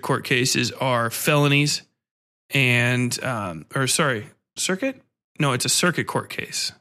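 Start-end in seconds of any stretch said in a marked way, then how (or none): 4.6-5.0 unsure question. Real, not acted. Confused self-correction about court type.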